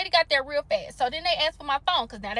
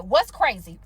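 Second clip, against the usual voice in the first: a lower, curt comeback with attitude; salon owner's attitude; 8 semitones lower, slower than usual. Retold or imitated. Salon owner snapping back at an upset customer, curt and lower. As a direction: snap back with an offended "What's crazy?". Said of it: "then she gets the attitude".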